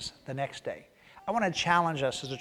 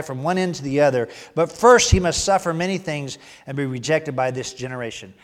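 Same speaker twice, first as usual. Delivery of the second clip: loud, raised reading of Jesus' words; preacher reading scripture aloud, loud and raised; scripture reading at full force; 4 semitones higher, 12 dB louder than usual. Acted. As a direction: proclaim the passage to the congregation.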